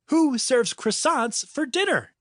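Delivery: put-on high, whiny kid voice; high complaining kid voice. Vale complaining after missing.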